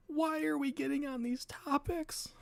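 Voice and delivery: high-pitched mock protest; high, put-on voice of disbelief; mock dismay. Mock protest at the chat's topics.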